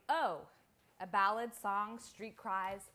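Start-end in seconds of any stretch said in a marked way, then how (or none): 0.1-0.4 a short exclaimed oh. Shown as a demonstration. Illustrating the word exclaim, mock exclamation. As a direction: blurt it out as an exclamation. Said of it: "Say or exclaim".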